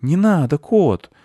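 put-on high, pleading kid voice; boy calling to cat. A narrator's reading. Boy stops cat creeping on roof, high-voiced. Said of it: "Мальчик окликнул серого кота".

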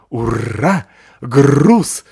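loud, happy dog growl. Acted. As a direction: bark it out like a thrilled big dog. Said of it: "рычал пёс". (громкий радостный рычащий собачий голос)

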